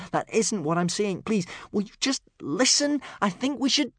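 put-on high-pitched, insistent boy's plea; pleading. A narrator's reading. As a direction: beg your father to listen. Son pleads, gets cut off.

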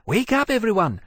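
high urgent call to rouse everyone; crying out. A narrator's reading. Rousing the sleeping dragons as pursuers come, crying out. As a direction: raise the alarm, pitched high. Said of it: "he cried".

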